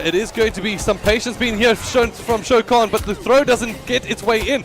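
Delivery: excited shouting. Real, not acted. Fight comes to round end.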